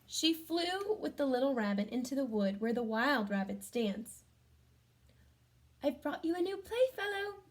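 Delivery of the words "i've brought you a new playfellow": fairy voice. Acted. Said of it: "the fairy said".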